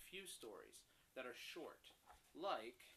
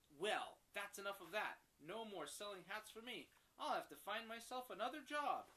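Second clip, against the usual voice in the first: fed-up hat seller; 5 semitones higher, 6 dB louder than usual. Acted.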